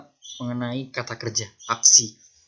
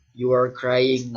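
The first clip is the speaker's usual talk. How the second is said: slow model sentence; 5 dB louder, much slower than usual. Shown as a demonstration. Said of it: "teacher models example sentence".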